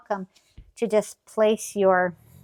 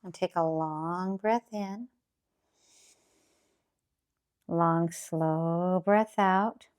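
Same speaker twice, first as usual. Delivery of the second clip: calm grounding exercise; slower than usual. Acted. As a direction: draw the breath out slowly. Guided breathing cue.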